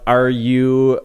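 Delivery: ordinary talk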